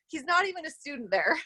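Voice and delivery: usual speaking voice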